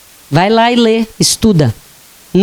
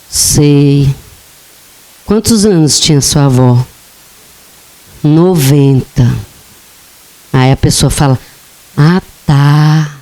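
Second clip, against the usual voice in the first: flat dismissive; 7 semitones lower than usual. Acted. Dismissive reply about old grandmother.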